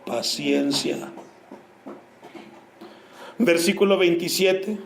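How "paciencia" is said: soft, slow emphasis. Acted. Answers own question, stressing word.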